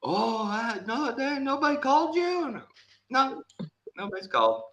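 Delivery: high, surprised put-on voice; imitating the plumber. Plumber acting shocked nobody called, high. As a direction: play the caught-out plumber. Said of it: "dialogue retold".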